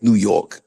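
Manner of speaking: "New York" with YORK rhyming with hawk; imitated New York accent. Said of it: "like a hawk".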